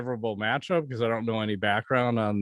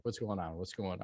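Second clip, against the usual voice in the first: muttered to himself; 5 semitones lower, 14 dB quieter than usual. Retold or imitated. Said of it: "I'm like".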